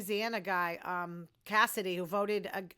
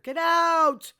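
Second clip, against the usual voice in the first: much higher and louder, a yelled get out; shouted order; 8 semitones higher, 13 dB louder than usual. Retold or imitated. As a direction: yell it at her to leave now. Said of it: "I told her".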